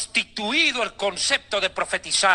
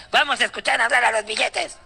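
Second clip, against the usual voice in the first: mocking Ana Méndez. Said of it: "como la mexicana Ana Méndez".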